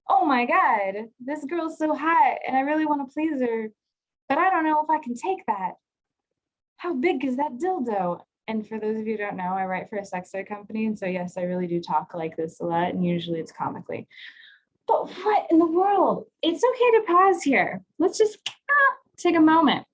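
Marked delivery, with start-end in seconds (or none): 0.1-8.2 lightly voiced torn lover; nervous partner's thoughts. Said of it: "he's just like". 14.9-19.8 much higher, louder put-on flustered voice; mock shocked. Mock shocked voice after joke.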